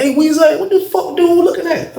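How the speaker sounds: high, urgent heads-up; voiced homeboy. The homeboy warning Weezy about the staring dude, high and urgent. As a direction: nudge your friend to look.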